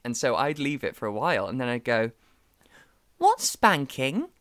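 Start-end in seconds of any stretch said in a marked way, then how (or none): 3.2-4.3 put-on innocent child question. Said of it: "Like that".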